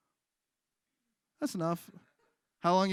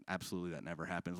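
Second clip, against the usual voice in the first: quiet quick denial; 7 semitones lower, 9 dB quieter, faster than usual. Acted. Denier voice.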